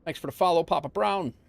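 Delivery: high, lively thanks; excited thank-you. Real, not acted. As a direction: sing out the thanks. Streamer thanks follower again.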